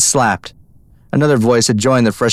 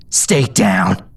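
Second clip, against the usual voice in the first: warning; 2 semitones lower than usual. A narrator's reading. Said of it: "she warned".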